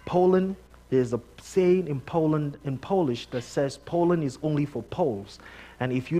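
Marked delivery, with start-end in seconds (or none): none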